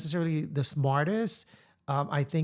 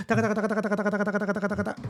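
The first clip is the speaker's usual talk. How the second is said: rapid-fire imitation of reading a script; higher, even machine-gun patter; really fast; 5 semitones higher, much faster than usual. Reporter imitating a rushed script read, really fast. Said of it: "And I said it really fast".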